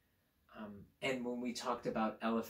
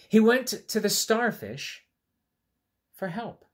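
higher, louder storytelling voice; storybook narration; 10 semitones higher, 10 dB louder than usual. A narrator's reading. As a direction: tell it brightly to little kids.

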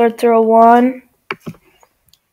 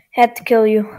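ordinary delivery

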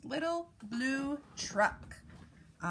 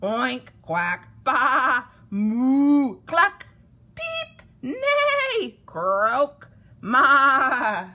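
loud run of varied animal calls; animal noises; 10 dB louder, much slower than usual. A narrator's reading. All animals scramble aboard, loud animal noises.

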